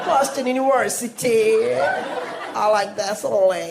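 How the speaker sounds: put-on slow, drawn-out posh American accent; mocking her accent. Host mimicking the daughter's accent, slow posh drawl. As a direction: stretch the vowels like the daughter. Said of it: "ऐसे बात करेगी क्या ये?".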